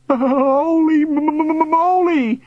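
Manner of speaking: high, loud, drawn-out stutter; terrified stammering cry. A narrator's reading. Bully under a swooping ghost, crying out. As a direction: wail it, stuttering with fright. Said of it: "Billy cried".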